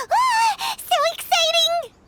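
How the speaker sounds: high, loud squeal of delight; thrilled. Acted. Paimon squealing to the Traveler about treasure, high and thrilled. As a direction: gush over the mystery treasure.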